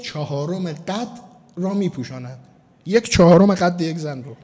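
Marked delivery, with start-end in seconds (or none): none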